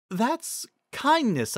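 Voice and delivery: high, slow pony voice; Cheerilee's voice. A narrator's reading. Narrator voicing Cheerilee naming the orb, high and slow. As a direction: say it slowly and high.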